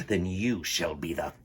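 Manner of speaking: hissed villain threat; Captain Hook whispers his threat to Wendy; menacing whisper. Acted. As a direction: whisper it like a gloating pirate captain.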